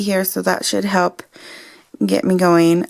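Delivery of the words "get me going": ordinary talk, nothing marked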